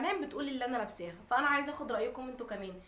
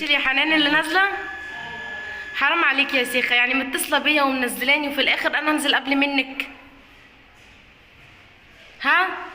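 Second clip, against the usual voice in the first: loud call up to the sister, half scolding; 4 semitones higher, 13 dB louder than usual. Real, not acted. Waiting downstairs, calling up loudly to sister Hanan. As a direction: shout up to the window, teasing complaint.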